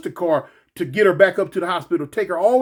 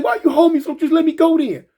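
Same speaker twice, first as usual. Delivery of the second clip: put-on higher, louder, upset girlfriend; girlfriend's voice; 10 semitones higher, 5 dB louder than usual. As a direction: pitch up into her angry whine. Girlfriend voiced in retold fight.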